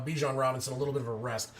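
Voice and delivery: ordinary talk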